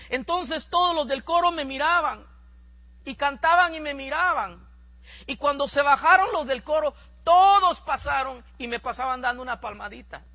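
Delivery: fervent preaching. Acted. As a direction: drive the testimony up with fire. Preaching testimony at full force. (predicación fervorosa, más alta y fuerte)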